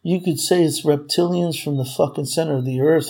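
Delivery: ordinary delivery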